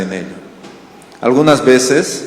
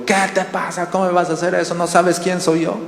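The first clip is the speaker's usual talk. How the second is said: mock indignant; 6 semitones higher, 4 dB quieter than usual. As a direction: play the offended big shot. Imagined angry retort to child. (indignado de broma, más agudo pero sin gritar)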